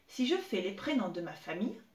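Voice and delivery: ordinary delivery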